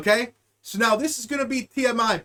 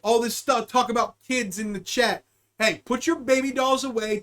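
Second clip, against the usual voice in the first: ordinary talk, nothing marked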